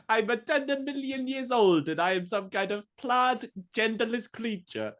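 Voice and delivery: high squeaky alien voice. Pretend. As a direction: ramble on as a tiny alien. Still voicing the alien.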